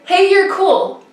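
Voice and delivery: high, tossed-off imitation of a passing biker; biker's casual compliment. Biker to a struggling racer, casual compliment. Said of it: "she's like".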